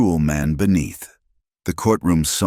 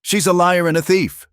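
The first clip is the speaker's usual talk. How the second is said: much higher, louder sudden outburst; composure slipping; 4 dB louder than usual. Acted. Husband losing control before the court, outburst. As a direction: let the control crack into rage. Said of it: "Harrison's composure slipped".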